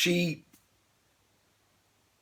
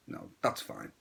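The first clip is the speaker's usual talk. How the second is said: whispered aside; 11 dB quieter than usual. Real, not acted.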